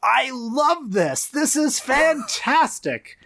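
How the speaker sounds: high, slow, stretched-out rapture; awestruck executive. Acted. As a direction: gush over it, drawing out the key words. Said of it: "and he's saying".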